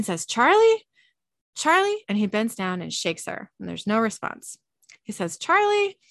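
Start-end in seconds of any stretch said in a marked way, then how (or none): 0.3-2.0 loud, high call of her name; calling a fallen friend. Retold or imitated. Dean calls to unconscious Charlie. 5.4-6.0 loud, high call of her name; calling a fallen friend. Retold or imitated. Dean calls to unconscious Charlie.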